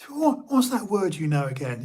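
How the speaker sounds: high, eager imitation of a friend; mimicking a high-voiced, teasing pal; Steve's high-pitched voice. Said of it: "quite high-pitched in a way".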